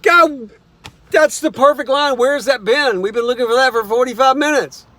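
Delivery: high, loud thrilled cheer; two writers cheering the perfect line; excited cheer. Retold or imitated. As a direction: shout it like you found gold. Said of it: "both went".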